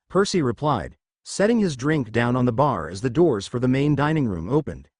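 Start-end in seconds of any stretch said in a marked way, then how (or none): none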